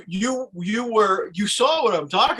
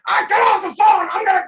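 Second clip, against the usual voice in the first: high shouted panic; mock frantic screaming; panicked shout; 13 semitones higher, 5 dB louder than usual. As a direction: yell as if someone's attacking. Faked rage for telemarketer.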